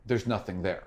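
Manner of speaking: slow, hushed vision narration; game master's scene setting. Acted. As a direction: draw them into the eerie vision slowly. GM describes the vision.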